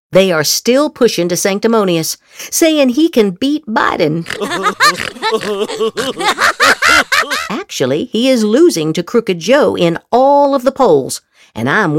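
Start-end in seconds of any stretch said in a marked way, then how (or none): 4.3-7.4 high rising scornful chuckle; a short derisive laugh that climbs; mocking laugh. Laughs at rival's claim.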